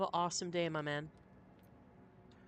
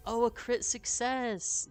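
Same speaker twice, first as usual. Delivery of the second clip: excited; 9 semitones higher, 7 dB louder, slower than usual. Real, not acted. Rolls a critical success.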